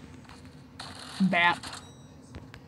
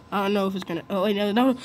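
ordinary talk

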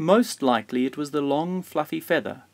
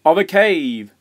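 loud, raised storybook shout; hearty call, louder and higher; 5 semitones higher, 7 dB louder than usual. A narrator's reading. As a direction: shout it out like a grandfather. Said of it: "shouted Grandfather".

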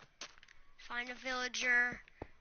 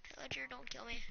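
whispering; 13 dB quieter, faster than usual. Real, not acted. Sneaking up on pillager.

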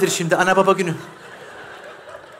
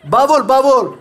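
high raised shout; calling out; 6 semitones higher, 4 dB louder, slower than usual. Acted. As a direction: repeat it loudly to someone outside. Shouting about bag to someone outside.

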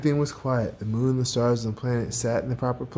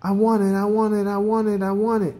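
higher, greedy chant; eager cry; 7 semitones higher than usual. A narrator's reading. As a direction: repeat it insistently in a raised pitch. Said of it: "he cried".

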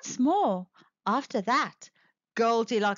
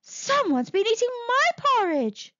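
higher, motherly bear voice; 9 semitones higher than usual. Acted. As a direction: speak like a mother bear, puzzled. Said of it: "said Mother Bear".

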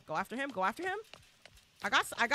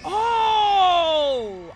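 excited oh; 4 semitones higher, 12 dB louder than usual. Real, not acted. Excited reaction while playing.

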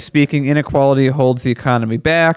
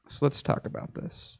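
hushed, unvoiced aside; whispered aside; 14 dB quieter than usual. Real, not acted. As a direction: drop to a whisper. Aside before reading.

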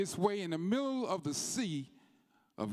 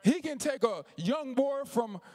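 preaching at full force; 9 semitones higher than usual. Acted. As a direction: build the sermon into a shouting crescendo.

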